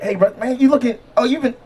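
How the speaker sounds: lightly voiced curious gym buddy; gym bro. Gym buddy asking the comic his weight-loss secret, eager gym-bro voice. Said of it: "brother man gonna come up to me and be like".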